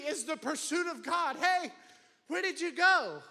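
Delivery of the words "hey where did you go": raised, louder calling out; voicing God calling. Preacher voices God calling for the hiding man. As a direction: call out like a searching father.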